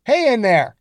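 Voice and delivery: high, loud call through a door. Acted. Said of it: "the voice called".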